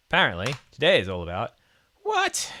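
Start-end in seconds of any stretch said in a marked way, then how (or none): 2.0-2.5 sharp startled what; surprised. Real, not acted. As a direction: caught off guard, shooting way up. Sudden error surprises him.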